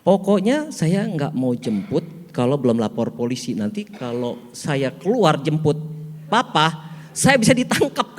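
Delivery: comic retold telling-off; wife snapping back. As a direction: play the indignant wife, building in pitch and volume. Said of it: "Terus dibentak lagi sama istrinya".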